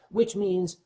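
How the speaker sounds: usual speaking voice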